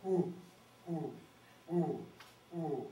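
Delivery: low hooting owl call; great horned owl hoot, lightly done. Shown as a demonstration. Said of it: "It goes like this".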